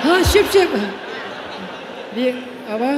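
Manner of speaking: stunned friend; shocked. Acted. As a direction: react in disbelief. Shocked at the name meaning.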